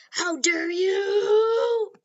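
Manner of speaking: high, loud, long-drawn "you"; outraged battle cry. Acted. Bakugo charging the villain, outraged battle cry. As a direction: stretch it into a rising, outraged yell.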